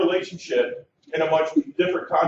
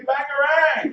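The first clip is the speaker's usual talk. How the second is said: shout; 10 semitones higher, much slower than usual. Shown as a demonstration. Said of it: "I want you to say".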